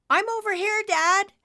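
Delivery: very high, loud yell. Acted. Nimbus calls to his father across the clouds, yelling. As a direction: shout across the sky to Dad. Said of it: "Nimbus yelled".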